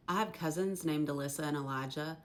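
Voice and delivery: ordinary talk, nothing marked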